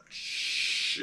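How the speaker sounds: a soft unvoiced hush; shushing. Player character hushing the captive woman in the dark, whispered. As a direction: hush her quickly and quietly. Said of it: "you're kinda going".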